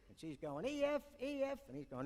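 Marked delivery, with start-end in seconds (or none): 0.7-1.6 much higher woman's voice calling to the ship; high-pitched wife calling up from the wharf. Retold or imitated. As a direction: sing out to her husband on the bridge.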